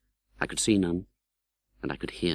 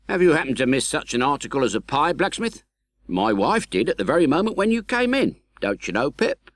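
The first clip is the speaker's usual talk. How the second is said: high, quick questioning officer; brisk sergeant; 7 semitones higher, 3 dB louder than usual. A narrator's reading. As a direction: press the blacksmith briskly. Sergeant voiced high in story.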